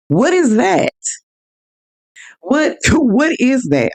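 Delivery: high, disbelieving what is that; genuinely baffled, half laughing; incredulous. Real, not acted. Asking her listeners about the rude cashier, incredulous.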